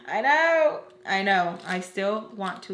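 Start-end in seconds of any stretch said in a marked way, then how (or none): 0.0-0.8 laughing, higher and louder; real laugh over the words. Real, not acted. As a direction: laugh out the admission. Laughs at own confession.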